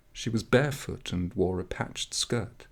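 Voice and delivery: ordinary delivery, nothing marked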